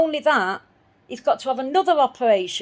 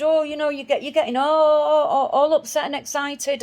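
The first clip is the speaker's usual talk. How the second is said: a lightly voiced, higher, patronising vet; mocking the vet; 6 semitones higher, 4 dB louder than usual. Quoting the vet dismissing her worry, mildly mocking. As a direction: brush her off like a condescending clerk. Mimicking the vet's brush-off.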